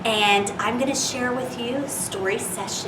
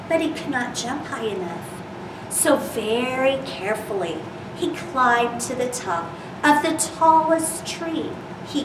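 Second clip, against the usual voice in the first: gentle storybook reading voice; reading to children. Acted. Reads picture book to children.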